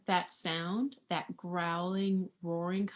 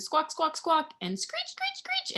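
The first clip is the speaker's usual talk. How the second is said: high squawky parrot voice; mock high parrot screeching; parrot squawk; 7 semitones higher than usual. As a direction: squawk each word like a parrot. Imitating parrot sounds in story.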